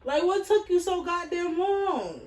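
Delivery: high, loud fed-up question; exasperated demand. Retold or imitated. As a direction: snap at him for taking so long. Annoyed at boyfriend's long delay.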